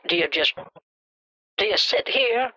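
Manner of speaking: ordinary talk, nothing marked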